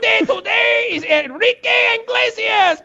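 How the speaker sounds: very high, shouted mock Mexican radio host accent; hammed-up Mexican DJ accent yelling with glee; over-the-top fake Mexican accent, screaming. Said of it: "doing this super racist Mexican voice".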